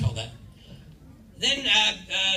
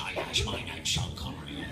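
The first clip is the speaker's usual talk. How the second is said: Sean Connery; 13 semitones lower, 6 dB quieter than usual. Acted. Panel guest mimics Scottish actor, low Connery impression. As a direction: drop right down into a famous Scottish actor's voice. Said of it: "Sean Connery, you know, he said".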